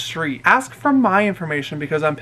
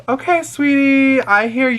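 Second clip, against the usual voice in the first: exaggerated soothing; 8 semitones higher, 4 dB louder than usual. Driver placates a bawling passenger, exaggerated soothing. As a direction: humour her with stretched-out sweetness.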